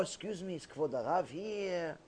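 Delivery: very soft, low, deferential voice; polite request. Shown as a demonstration. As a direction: ask gently, as if at a rabbi's door. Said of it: "Ask people politely".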